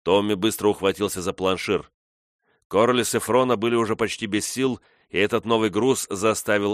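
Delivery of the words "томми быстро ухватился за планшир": ordinary delivery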